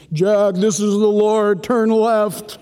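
mock booming divine voice, slow; voice of the Lord. Preacher mocks God calling Jack, booming voice. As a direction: play God giving directions.

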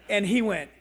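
ordinary delivery

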